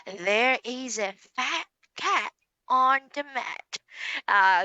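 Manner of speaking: put-on slow, careful teacher reading for a child; slow model sentence. Shown as a demonstration. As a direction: read slowly line by line. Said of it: "That was very impressive".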